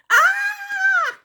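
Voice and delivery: high loud cry of pain. A narrator's reading. Elsie poked in the eye, high cry of pain. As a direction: yelp in pain.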